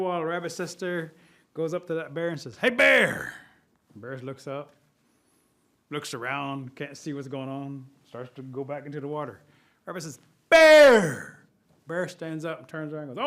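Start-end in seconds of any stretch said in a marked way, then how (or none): 2.6-3.3 loud, high holler; calling out. Acted. Sister calls out to bear. 10.5-11.5 very loud, high holler; shouted call. Acted. Rabbit calls out to bear.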